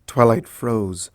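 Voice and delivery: usual speaking voice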